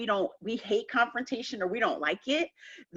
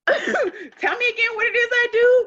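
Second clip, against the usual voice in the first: higher, louder, laughing through the husband's retreat; husband backpedaling laughing; 8 dB louder than usual. Retold or imitated. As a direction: laugh while playing the husband giving in. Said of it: "And then he was like".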